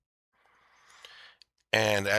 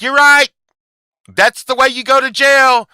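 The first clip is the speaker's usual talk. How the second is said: much higher and louder, a shrill-sounding mocking shout; mock yell; 12 dB louder than usual. Imitating her threatening jail, loud high mock shout. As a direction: blast it out like a scolding official. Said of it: "And she was like".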